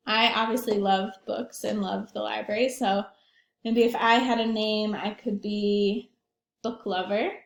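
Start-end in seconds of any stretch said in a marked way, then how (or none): none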